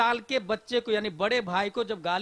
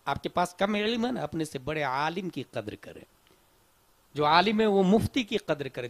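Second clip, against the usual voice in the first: ordinary talk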